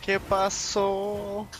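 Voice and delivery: very slow, stretched mock question; slow drawn-out. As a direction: drag every syllable out. Echoing friend's slowed line.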